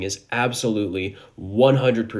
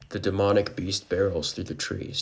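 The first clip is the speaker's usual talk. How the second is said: ordinary delivery, nothing marked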